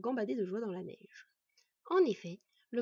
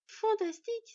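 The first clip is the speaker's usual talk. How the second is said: very high, loud exclamation of delight; 12 semitones higher, 6 dB louder than usual. A narrator's reading. Boy meeting his father's killer, delighted. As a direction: burst out with joy. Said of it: "s'épanouit le garçon".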